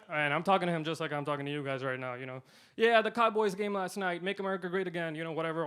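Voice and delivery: usual speaking voice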